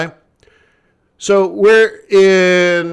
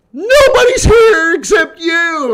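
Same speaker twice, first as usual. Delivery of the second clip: higher, louder, slow alarmed cry; panicked shout; 12 semitones higher, 6 dB louder, slower than usual. Retold or imitated. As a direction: imitate the frantic staff yelling. Panicked organizers quoted.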